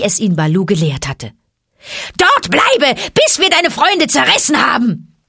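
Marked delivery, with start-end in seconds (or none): 2.2-5.2 high, loud jeering roar; roaring monkeys. A narrator's reading. Monkeys roar at Mowgli in the pit, high and loud. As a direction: bellow down at the trapped boy. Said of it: "brüllten die Affen".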